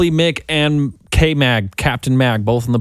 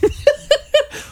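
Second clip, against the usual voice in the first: high giggle. Real, not acted.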